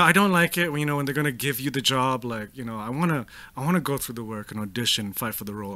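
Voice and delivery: a hint of Kristen Stewart trailing off quietly; understated quiet imitation of a shy actress; lightly voiced Kristen Stewart. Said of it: "So Kristen Stewart's like".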